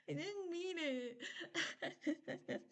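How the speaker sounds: high, slow, laughing through a mock-tearful apology; giggling sorry, stretched out. Real, not acted. Apologizing to viewers she made cry, laughing and stretched. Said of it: "I made you guys cry".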